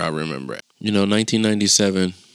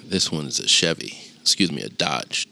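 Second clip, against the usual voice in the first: ordinary talk, nothing marked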